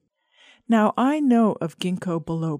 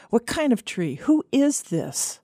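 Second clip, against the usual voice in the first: higher, emphatic questioning of a crank caller; mock-indignant phone challenge; feigned suspicion; 5 semitones higher than usual. A narrator's reading. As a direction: play along, demanding to know who's calling. Pretends it's a crank call.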